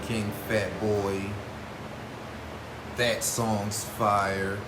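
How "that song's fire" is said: slow, stretched-out praise; lazy stoner drawl. Real, not acted. Laid-back hype for a song.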